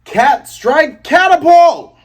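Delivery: stretched, high battle cry; yelled attack name. Retold or imitated. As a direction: bellow the move name like an anime fighter. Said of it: "and yells".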